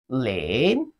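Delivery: "lin" dipping low, then rising; falling-rising tone. Shown as a demonstration. Teacher recaps the count for learners, falling-rising "lin". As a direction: draw out "lin" in a dip and rise. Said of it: "Falling and rising tone".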